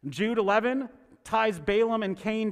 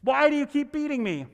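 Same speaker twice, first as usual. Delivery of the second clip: higher, pained plea of a beaten donkey; lightly voiced donkey begging its master to stop; pleading donkey voice; 7 semitones higher than usual. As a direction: cry it out like the hurt animal asking why. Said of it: "The donkey looks at Balaam and says".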